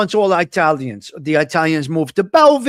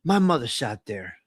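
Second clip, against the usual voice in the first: hushed inner thought; 6 semitones lower, 7 dB quieter than usual. Retold or imitated. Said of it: "and I'm saying".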